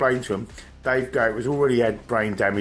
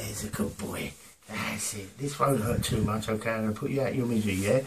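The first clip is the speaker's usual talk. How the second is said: mock soothing; 7 dB quieter than usual. Attacker settles substitute sheep before beating it, soft mock soothing.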